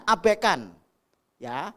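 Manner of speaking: loud, high, driving sermon voice; full-force preaching. Acted. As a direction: preach it out over the whole congregation.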